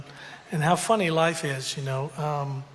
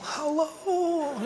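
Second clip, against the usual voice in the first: put-on very high, frail, quavering old man's hello; feeble hello; 12 semitones higher than usual. Dad woken at night, feeble high hello. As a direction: croak it out like a sleepy frail old man. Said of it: "I get this feeble".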